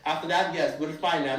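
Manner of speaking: rough imitation of a South African accent; put-on South African accent. Posing as South African to a driver, in put-on accent. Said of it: "kinda put on my best South African accent".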